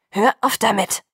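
sharp, loud angry snap; snapping angrily. A narrator's reading. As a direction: bite his head off. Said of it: "fuhr Jacob ihn an".

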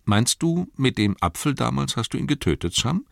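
put-on eager boyish question; Pippin asking. Pippin asking Sam about the apple, high boyish voice. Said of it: "sagte Pippin".

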